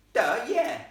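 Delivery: loud, high sarcastic jeer; mock her for stating the obvious; rough boy's loud sneer; scornful. Ghost scorns the obvious question.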